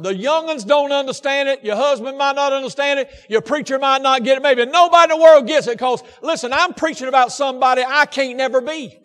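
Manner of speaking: pressing each phrase on the congregation; impassioned preaching. Real, not acted. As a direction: preach it with force. Preaches at full force.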